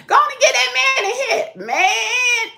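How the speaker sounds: mock yell. Retold shout at runner.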